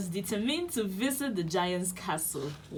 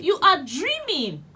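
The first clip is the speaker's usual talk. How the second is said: dismissive giant's wife; 8 semitones higher, 7 dB louder than usual. Acted.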